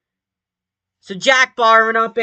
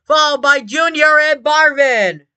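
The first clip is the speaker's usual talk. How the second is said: motorsport commentator; 6 semitones higher, 5 dB louder than usual. Acted. Live race play-by-play after crash.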